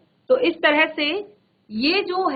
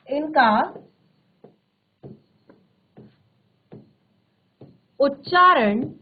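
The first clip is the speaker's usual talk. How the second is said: dictation pace; slower than usual. Shown as a demonstration. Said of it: "slow dictation of the definition".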